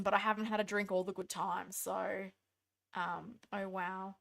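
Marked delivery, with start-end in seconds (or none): none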